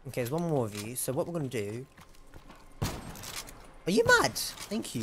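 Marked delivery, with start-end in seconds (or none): 2.8-5.0 high, drawn-out mocking "Are you mad?"; sing-song taunt at a dying zombie. Taunting the zombie he just killed, mock high and slow.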